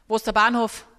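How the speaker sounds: loud, blunt demand for the station. Retold or imitated. Stranger pushily asks the way to the station, loud demand. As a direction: bark the question at a stranger.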